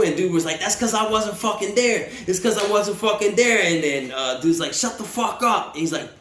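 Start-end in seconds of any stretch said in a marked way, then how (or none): 0.6-3.6 higher-pitched indignant comeback; retold defiant protest; acted heated protest. Acts out homie's heated protest. 4.7-5.7 acted angry order. Acts out guard's angry order.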